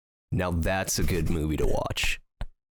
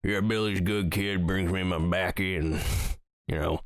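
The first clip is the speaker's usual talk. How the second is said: put-on lightly done Southern hillbilly accent, unhurried. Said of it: "deep South redneck guy".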